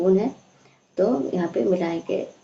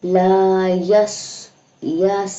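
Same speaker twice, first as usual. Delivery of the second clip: slow, drawn-out chanted recitation; tajweed recitation, slow and measured; Quran recitation; 3 dB louder, much slower than usual. Shown as a demonstration. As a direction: recite it melodically, stretching each vowel. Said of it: "teacher recites the verse".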